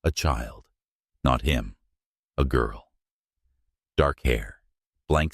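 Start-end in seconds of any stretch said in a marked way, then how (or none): none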